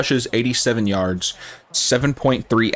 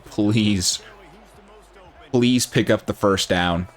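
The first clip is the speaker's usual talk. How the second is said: holding breath; 3 semitones lower, slower than usual. Real, not acted. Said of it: "I'm literally just holding my breath saying".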